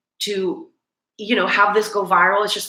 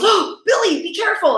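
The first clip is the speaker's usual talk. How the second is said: crowd calling out; 13 semitones higher than usual. Retold or imitated. Fans calling to [name] on the stairs, high worried call. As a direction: call up to the stage like a worried fan. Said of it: "everyone goes".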